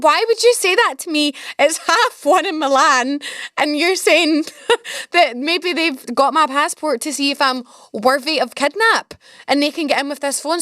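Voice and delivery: laughing through the words, half panicked; real laughter breaking the complaint. Real, not acted. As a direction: giggle while protesting. Retelling the fright, laughing.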